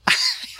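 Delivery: shrieking high laugh; high gleeful cackle. Real, not acted. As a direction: burst of high giggling laughter. Prank worked, laughing.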